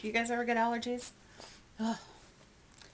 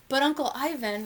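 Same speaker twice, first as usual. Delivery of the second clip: put-on higher, louder child's plea; pleading; 5 semitones higher, 5 dB louder than usual. A narrator's reading. Young elephant pleading to go along, insistent and high. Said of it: "Ruby pleads".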